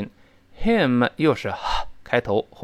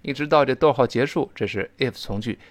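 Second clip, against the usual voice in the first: ordinary talk, nothing marked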